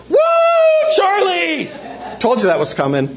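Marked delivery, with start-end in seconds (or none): 0.1-1.7 very high drawn-out whoop; exploding cheer. Retold or imitated. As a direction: erupt like a celebrating poolside crowd. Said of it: "and it explodes".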